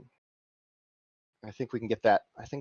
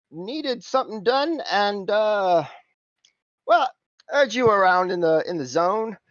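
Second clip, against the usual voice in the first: higher, louder cockney geezer voice; cockney accent; 9 semitones higher, 4 dB louder than usual. Acted. GM as Simon greeting the crew, cockney accent. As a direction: play a chummy cockney fixer. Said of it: "Durier's reaction to [name]'s accent".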